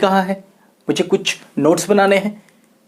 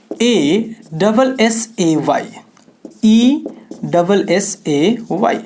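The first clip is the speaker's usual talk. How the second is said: slow letter-by-letter spelling; much slower than usual. Shown as a demonstration. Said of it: "spelling letters slowly".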